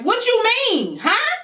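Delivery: can't believe what the brother just said; shocked disbelief. Retold or imitated. Hearing from her brother that her boyfriend is with dad, high shocked question.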